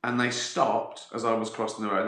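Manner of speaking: ordinary talk, nothing marked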